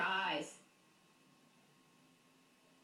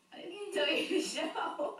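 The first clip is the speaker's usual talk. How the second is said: laughing; 7 semitones higher, slower than usual. Real, not acted. Laughing at baby showing belly.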